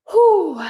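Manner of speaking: loud relieved exhale after exertion; relieved whew. Real, not acted. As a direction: blow out a big "whew". Exhale after hard cardio set.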